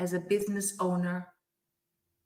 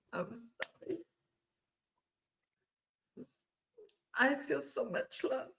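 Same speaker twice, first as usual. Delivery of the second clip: emotional; 3 semitones higher, 8 dB quieter than usual. Real, not acted. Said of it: "I'm a bit emotional".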